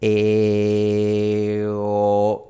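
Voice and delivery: E then O, sustained and clear; vowel demo. Shown as a demonstration. Teacher showing tongue moving front to back. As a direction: hold E, then slide back to O. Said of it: "pasar de una E a una O".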